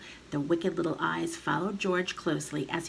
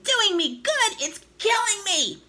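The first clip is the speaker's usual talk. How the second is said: high, loud indignant shriek; outraged scream; 5 dB louder than usual. A narrator's reading. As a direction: scream in disbelief and agony. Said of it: "she screamed".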